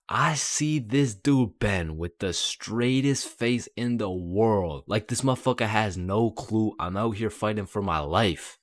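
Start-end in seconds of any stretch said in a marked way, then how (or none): none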